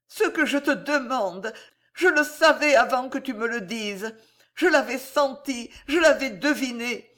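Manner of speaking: high, fervent, insistent heroine; impassioned woman in love. A narrator's reading. Voices Corisandre in the story.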